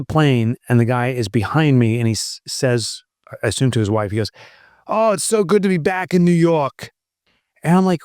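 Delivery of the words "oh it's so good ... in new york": high-pitched mock New York accent. Imitating passenger to his wife in a heavy New York accent. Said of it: "these heavy New York accents".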